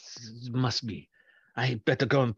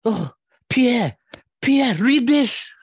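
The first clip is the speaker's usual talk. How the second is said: panicked, high, loud intern; nervous young professional; 8 semitones higher, 6 dB louder, slower than usual. Acted. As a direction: burst in crying out in alarm. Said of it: "had a nervous intern run in".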